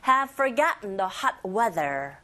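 slow, light-"have" model for repeating; slow teacher model; slow model reading. Shown as a demonstration. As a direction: pace it for learners. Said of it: "따라해보세요".

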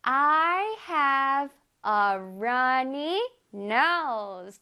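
very slow, careful English; slow teacher demo; slow model reading. Shown as a demonstration. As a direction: read each word slowly for students. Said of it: "해볼게요".

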